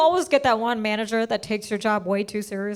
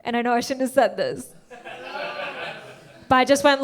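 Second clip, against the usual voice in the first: ordinary talk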